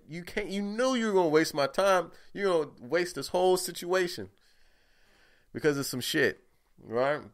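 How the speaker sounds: heated rant. Acted. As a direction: fire it back at her, fed up. Ranting back at her.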